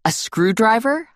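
slow, rising disbelief on screwdriver; incredulous question. Acted. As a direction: ask it like you can't believe it. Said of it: "I asked her".

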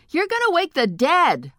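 high, loud yell; yelling back. Acted. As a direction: yell back across the hall. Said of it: "I yelled back".

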